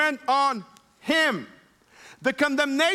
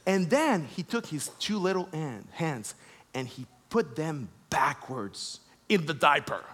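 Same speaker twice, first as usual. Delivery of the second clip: ordinary delivery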